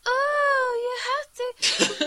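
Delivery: put-on high, slow, tearful little girl; creepy little girl voice. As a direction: whimper it like a creepy child. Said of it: "this really creepy little girl voice".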